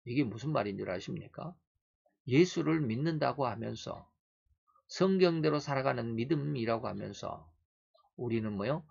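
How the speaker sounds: usual speaking voice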